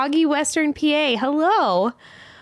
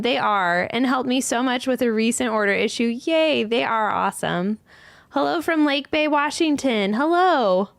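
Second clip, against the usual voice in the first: ordinary talk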